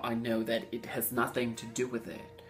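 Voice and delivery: usual speaking voice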